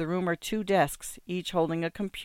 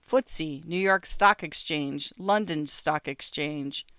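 ordinary delivery

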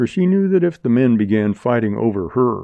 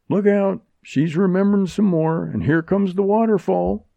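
high-pitched mock warning call; jeering call, pitched well up; 9 semitones higher than usual. A narrator's reading. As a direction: call it out high, without shouting. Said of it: "called another".

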